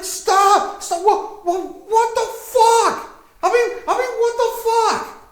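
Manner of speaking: very high, loud, frantic screeching; shrieking panicked necromancer. Acted. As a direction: shriek in total disbelief at the loss. Necromancer keeps ranting at party.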